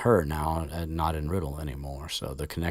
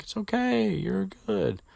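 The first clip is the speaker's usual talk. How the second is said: comforting Ron. Retold or imitated. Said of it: "Ron's like".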